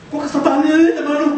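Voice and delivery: high, fed-up half-sobbed complaint; mock angry crying. Mary crying in annoyance at Jesus. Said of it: "Saking keselnya sampai nangis".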